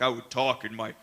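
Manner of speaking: low, slow booming giant; deep giant voice. Shown as a demonstration. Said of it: "my deep giant voice like this".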